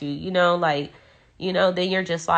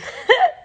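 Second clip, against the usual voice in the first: emotional laugh; 7 dB louder than usual. Real, not acted. Overwhelmed crying laugh on stream.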